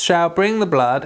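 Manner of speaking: usual speaking voice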